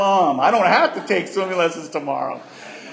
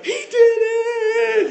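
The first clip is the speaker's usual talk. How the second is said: high, slow triumphant shout; cheering crowd; 4 dB louder, much slower than usual. Retold or imitated. Friends at the pool cheer as Ricky finally jumps, high slow shout. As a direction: yell it like the kids cheering him on. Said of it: "all these people like".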